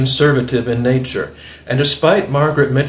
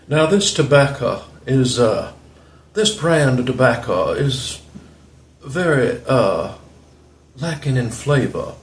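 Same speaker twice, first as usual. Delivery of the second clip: Alan Harrelson, put-on Southern accent. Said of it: "that's what he sounds like sometimes".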